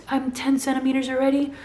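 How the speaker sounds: mother stunned at her own progress; incredulous echo. Retold or imitated. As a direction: throw it back in shock.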